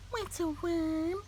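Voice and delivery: high, soft little bird voice; small bird voice. A narrator's reading. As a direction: ask like a tiny curious chick. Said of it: "asked Early Bird".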